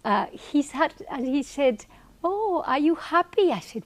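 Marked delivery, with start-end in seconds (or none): 2.2-3.5 high-pitched retold question from the guru; mock guru, pitched up; imitating the guru. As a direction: lift the voice high to play him asking. She voices the guru's question.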